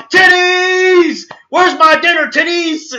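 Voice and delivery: high imitated yell; Goku. Cartoon hero yelling for his dinner, high mock shout. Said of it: "Goku yelling out".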